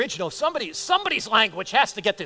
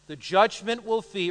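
ordinary talk, nothing marked

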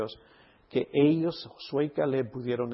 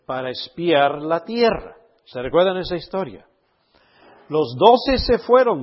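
loud, emphatic sermon delivery; forceful, raised preacher's voice; preaching; 3 semitones higher, 11 dB louder than usual. Real, not acted. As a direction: preach it out to the whole congregation, punching the key words. Preaches the spies story at full force.

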